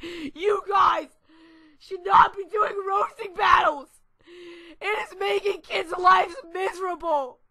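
high, loud shrieking mock mom; angry mom imitation. Boy imitating the furious mom who scolds the kids, screeching. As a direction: screech like an outraged mom, hammed up. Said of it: "And she's all like".

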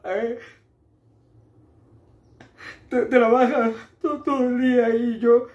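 high, whining, sobbing protest; tearful stammer. Acted. Tearful protest about stolen money.

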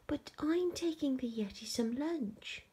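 a hint of an earnest young explorer; lightly voiced boy. George explains his errand to Betty, lightly voiced high boy.

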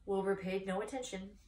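ordinary delivery

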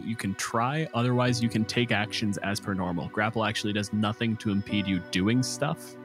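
ordinary talk, nothing marked

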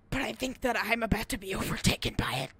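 unvoiced dramatic whisper; final words whispered to viewers. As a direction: hush it like a last confession. Dying confession to camera.

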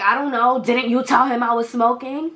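imitated irritated comeback; retold snappy retort. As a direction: snap back at mom, accusing.

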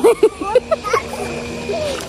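high rising giggle. Real, not acted. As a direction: giggle at the scene. Watching the friend refuse.

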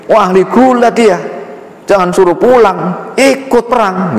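high, slow, impressed voice; impressed leader. Acted. The Prophet lets the wrestler boy join, high and impressed. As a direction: marvel at the boy, slowly.

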